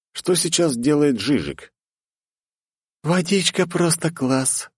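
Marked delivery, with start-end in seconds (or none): 3.0-4.7 put-on high, slow blissful girl voice. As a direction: sigh it out slowly with bliss. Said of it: "блаженно потянулась Полина". (блаженно, высоким голосом)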